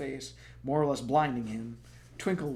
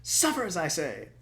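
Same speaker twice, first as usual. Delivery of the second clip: dramatic; 4 semitones higher, 5 dB louder than usual. Acted. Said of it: "said dramatically".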